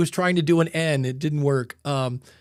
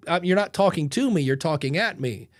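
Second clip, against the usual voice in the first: normal talking voice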